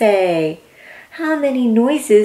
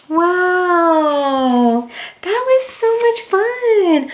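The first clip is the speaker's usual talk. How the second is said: excited; 9 semitones higher, slower than usual. Real, not acted. Ending the story with a child.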